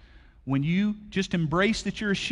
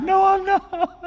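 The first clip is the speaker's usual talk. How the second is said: high, loud whining child, hammed up; whiny toddler voice; 8 dB louder, slower than usual. Preacher imitating his tired kid denying it, whiny. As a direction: whine it like an overtired kid.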